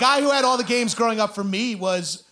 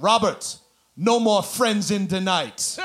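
slow, sing-song Jamaican dad; a stern Jamaican father, drawn out; Jamaican accent; slower than usual. Retold or imitated. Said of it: "I'm doing an impression of a specific person".

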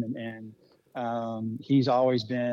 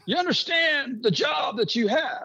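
much higher, slow, weighty mentor voice; mentor's voice; 13 semitones higher, slower than usual. Retold or imitated. Mentor phoning the new track boss with a warning, high and weighty. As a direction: play the older mentor laying down the stakes.

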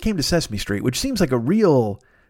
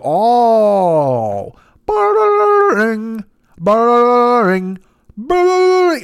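very high, louder jaw-rattling brrrring; alien phone mimic; 9 semitones higher, 6 dB louder, much slower than usual. Aliens copy the phone to each other, high rattling trill. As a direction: mimic the ring with a wobbling jaw. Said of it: "move their jaws".

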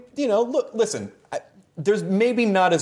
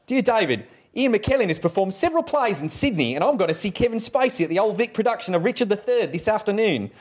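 higher, exaggerated Australian letter-reading voice; mangled Australian with a touch of Cockney; bad Australian accent; 6 semitones higher than usual. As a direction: read it out in a badly faked Australian accent. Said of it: "I do a bad Australian accent".

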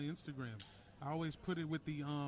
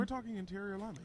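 excited; 7 semitones higher, faster than usual. Real, not acted. Hyping up linemen.

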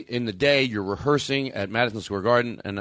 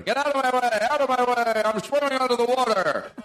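high muffled underwater yell; shouting underwater; 10 semitones higher than usual. Pretend. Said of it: "I'm talking underwater".

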